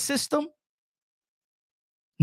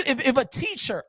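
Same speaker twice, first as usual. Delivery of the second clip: normal talking voice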